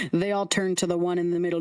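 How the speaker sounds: ordinary talk, nothing marked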